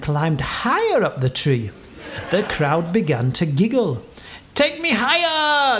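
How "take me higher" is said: high-pitched, drawn-out command; ordering. A narrator's reading. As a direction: call the order up high, stretching it. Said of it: "the captain ordered".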